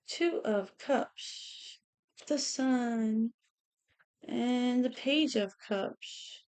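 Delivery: slow, hushed tarot card callout; name each card softly and slowly; slow card reading. Acted. Laying out tarot cards.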